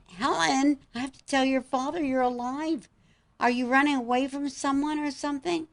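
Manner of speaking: high, insistent pleading; urgent disbelief. Retold or imitated. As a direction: press her with breathless questions. Mother urging daughter, astonished.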